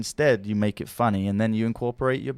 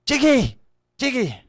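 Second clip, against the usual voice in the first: high-pitched calling voice; friend's voice calling out; 5 dB louder than usual. Acted. Friend calling to the daydreamer in headphones, high urgent call. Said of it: "you can hear this voice going".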